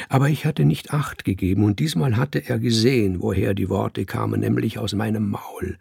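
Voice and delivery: ordinary delivery, nothing marked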